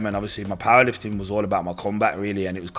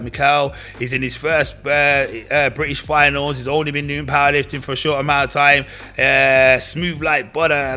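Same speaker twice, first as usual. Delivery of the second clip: a light take on a compere hyping up a lifter; raised, louder, selling him to the hall; 5 semitones higher, 5 dB louder than usual. Retold or imitated. Compere on the mic gassing up the lifter, raised and loud. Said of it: "She's like".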